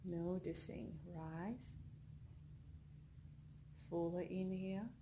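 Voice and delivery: soft, unhurried meditation voice with long pauses; guided meditation. Acted. Leads a yoga nidra relaxation.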